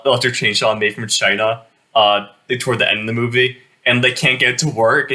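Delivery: ordinary delivery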